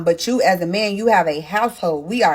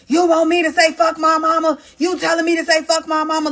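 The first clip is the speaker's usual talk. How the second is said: high-pitched mock yell, held on one note; retold yelling; 8 semitones higher than usual. Woman retells her man's sudden turn, mock yelling. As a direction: imitate his outburst, pitched up without full volume. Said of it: "Then he's yelling".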